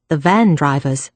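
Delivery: ordinary delivery, nothing marked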